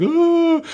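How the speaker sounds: loud, very high drawn-out "oh"; mock frustrated wail. Kid blamed for Mom missing the ride, mock wail. Child blamed by family cries out.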